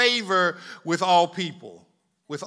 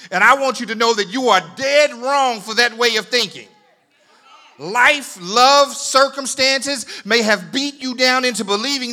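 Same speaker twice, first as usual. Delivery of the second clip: loud, building sermon delivery; fiery preaching; 5 semitones higher, 11 dB louder than usual. Real, not acted. Preacher telling the congregation they matter, forceful preaching. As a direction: drive it home, rising to a shout.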